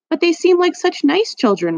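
lifted, pleading kind voice; high pleading dinner lady. A narrator's reading.

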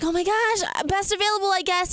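high, flustered retold reply. Retells nervous answer to machine.

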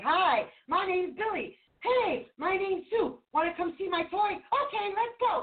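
put-on chirpy child making friends. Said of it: "kids are like".

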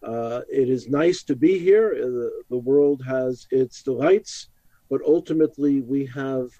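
ordinary talk, nothing marked

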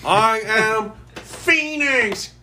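high, slow, drawn-out heroic shout; superhero battle cry. Acted. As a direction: bellow it like a comic hero. Superhero call from X-Men.

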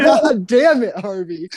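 high-pitched mock scolding through laughter; laughing exasperation. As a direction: scold your buddy while cracking up. Retelling scolding his friend, laughing.

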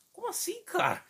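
put-on very high squeaky teenage voice; teen voice cracking. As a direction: squeak like a boy whose voice is breaking. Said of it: "Aquela voz de adolescente".

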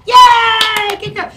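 very loud, high cheer; over-the-top squealed praise; making a big deal. Real, not acted. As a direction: whoop with delight at the dog. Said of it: "see how I make a big deal".

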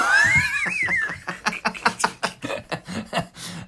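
very high squealing laugh; shrieking laugh. Real, not acted. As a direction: burst into a high shrieking cackle. Hysterical laugh after shouting.

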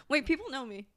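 ordinary talk, nothing marked

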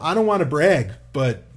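bragging with a giggle; half-laughing humblebrag; sheepish pride. Real, not acted. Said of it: "But you do wanna brag".

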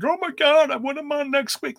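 very high, put-on gushing voice; mock overexcited fan. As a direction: imitate a fan jumping up and down, high-pitched. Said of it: "jump up and down and be like".

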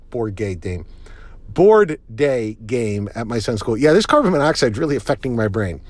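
usual speaking voice

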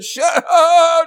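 high, loud shout of shut up; God says shut up. Acted. God shouting at Peter to shut up. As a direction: cut Peter off with a loud cry. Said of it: "he said".

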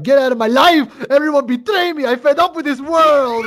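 loud, high mock rage; hammed-up angry bellow; furious shout. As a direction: yell it like a dad at the end of his rope. Furious dad throws Buddy out.

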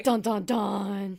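drawn-out "dun, dun, dun"; spoken soap-opera reveal sting; mock dramatic sting. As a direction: slowly intone a suspense cue.

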